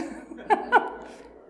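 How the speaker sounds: a quick breathy laugh after the joke; short laugh. Real, not acted. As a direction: let a light laugh slip out. Laughs at own joke about swan.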